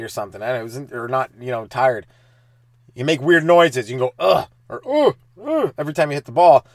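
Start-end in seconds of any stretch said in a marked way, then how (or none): 4.2-4.5 short effortful tennis grunt. Shown as a demonstration. 4.9-5.7 two sharp high tennis grunts. Shown as a demonstration.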